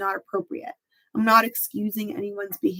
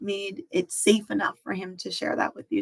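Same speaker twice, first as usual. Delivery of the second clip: normal talking voice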